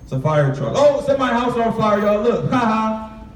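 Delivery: gleeful mocking kid pointing at the fire; mocking laughter. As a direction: jeer and laugh like a careless kid. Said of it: "I'm like".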